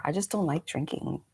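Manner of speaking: normal talking voice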